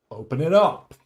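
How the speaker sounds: loud hammed-up command; mock bossy order. Streamer ordering himself to eat dog food, loud mock command. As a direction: bark it like a drill sergeant at dinner.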